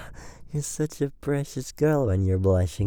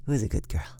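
ordinary delivery

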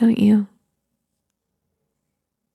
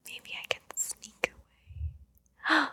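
whispering; 5 semitones higher, 16 dB quieter than usual. Acted. Sneaking away from sleeping baby.

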